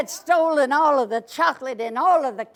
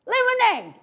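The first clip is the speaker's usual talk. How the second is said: hero ordering lemonade; 8 semitones higher, 6 dB louder than usual. Acted. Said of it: "and he said".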